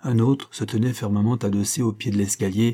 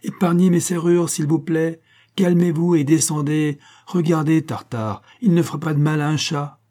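high, silvery voice, projected; vibrant calling out; 6 semitones higher, 4 dB louder than usual. A narrator's reading. Shirley calming the gentlemen upstairs, calling out vibrantly. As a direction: call up to them brightly and firmly. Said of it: "leur cria Shirley de sa voix argentine mais vibrante".

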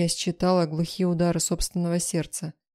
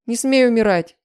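loud, high-pitched command; frantic, forceful yell; desperate shout; 5 semitones higher, 9 dB louder than usual. A narrator's reading. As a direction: forbid it at full voice. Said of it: "закричала я".

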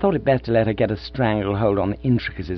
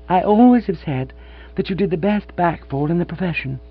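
aunt character; 7 semitones higher than usual. A narrator's reading. Aunt's voice held in story.

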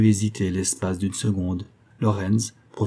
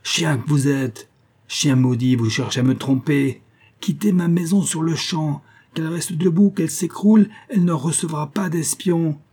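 furious rage; 7 semitones higher, 3 dB louder than usual. A narrator's reading. The hostess curses the blacksmith who tried to trick her, furious high shrieking. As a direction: spit the insults and build into a scream.

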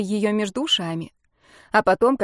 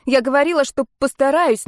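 almost shouting; 5 semitones higher, 4 dB louder than usual. A narrator's reading. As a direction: nearly shout it in distress. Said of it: "я почти закричала".

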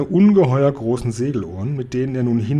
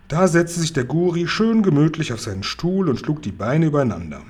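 ordinary delivery

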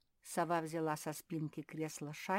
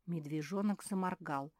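plain reading voice

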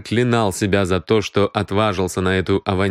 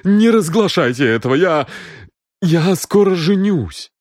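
higher-pitched, desperate begging; trembling plea; 7 semitones higher than usual. A narrator's reading. Хэй begs not to be exposed before marrying, trembling. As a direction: beg anxiously, stumbling on the last words. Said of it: "которого уже била дрожь".

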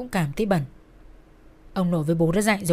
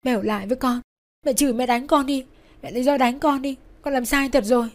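raised, urgent pleading; frantic begging; 4 semitones higher, 3 dB louder than usual. A narrator's reading. Daughter clinging to dying mother's bed, begging. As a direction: beg desperately, plea upon plea. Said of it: "van xin rối rít".

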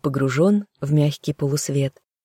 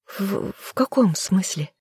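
shaky, stammering and slow, higher; trembling voice; 5 semitones higher, slower than usual. A narrator's reading. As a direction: voice quivers as she stammers. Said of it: "Голос дрогнул".

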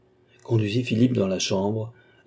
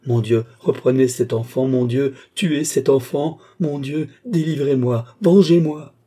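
desperate angry prayer, building higher and louder; frightening anger; 5 semitones higher, 6 dB louder than usual. Acted. Desperate mother begs God for deliverance, with rising fury. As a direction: build to a loud, high cry for vengeance. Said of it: "Effrayante de colère et sublime d'action".

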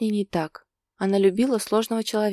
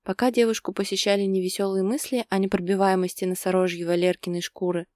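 ordinary delivery

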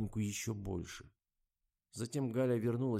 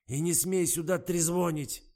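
loud, higher, slowed-down yell; angry shout; 9 semitones higher, 7 dB louder than usual. A narrator's reading. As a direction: slam the order down on her. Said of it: "гаркнул милый дедуся".

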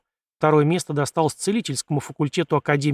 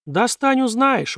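higher, teasing smirk; 9 semitones higher, much slower than usual. Acted. Kim daring the prince to fetch the prize, smirking. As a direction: say it with a sly grin. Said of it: "ухмыльнулся Ким".

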